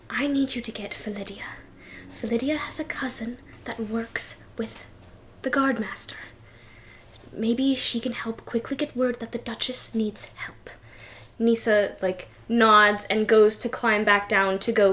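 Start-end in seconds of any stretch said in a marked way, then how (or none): 0.1-10.7 fast hushed whisper to her maid; urgent whisper. Acted. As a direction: whisper the plan quickly, voice unvoiced.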